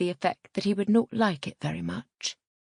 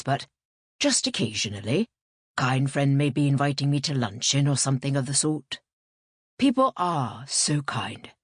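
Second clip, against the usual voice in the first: lower-pitched, emphatic clergyman; Father Thames; 5 semitones lower, 3 dB louder than usual. A narrator's reading. Priest explaining his church's telephone calls, lower and emphatic. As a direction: play the priest, firm and lower.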